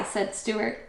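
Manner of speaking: ordinary delivery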